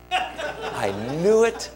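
stretched, rising mock-elated exclamation; retold elation. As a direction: draw it out, imitating delighted vindication. Retold relief at hearing his calling.

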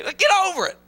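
ordinary delivery

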